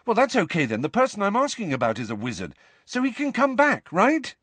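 high, eager rush of hope; relieved. A narrator's reading. Harry thinks his wizard godfather can return, relieved. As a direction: hurry on hopefully, rising high. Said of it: "almost laughed with relief".

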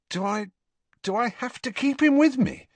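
high, dismayed stammer; horrified at the idea; aghast. Acted. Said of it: "Harry asked aghast".

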